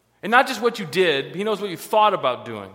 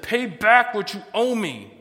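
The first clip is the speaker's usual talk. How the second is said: slow, slightly raised narrated demand; 4 semitones higher, 4 dB louder than usual. A narrator's reading. Servant choking a man who owes him rent, demanding. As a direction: press the demand slowly and firmly. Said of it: "he demanded".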